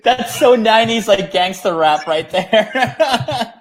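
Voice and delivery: amused, laughing high delivery. Real, not acted. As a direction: crack up while commenting, stretching the last words. Cracking up at rap line.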